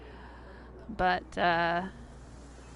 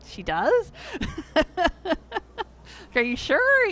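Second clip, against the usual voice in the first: high, louder retold double-take; mock disbelief; 9 semitones higher, 5 dB louder than usual. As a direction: act out being incredulous at the answer. Retells doubting family's answer.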